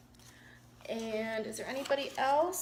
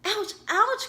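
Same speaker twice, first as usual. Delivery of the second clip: owl crying ouch; 9 semitones higher, 4 dB louder than usual. Acted. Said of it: "cried Little Owl".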